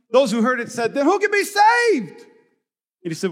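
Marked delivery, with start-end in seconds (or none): 0.9-2.2 high, loud and slow; shocked cry. Acted. Listeners stunned by the camel saying, crying out high and loud. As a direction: blurt it out in alarm.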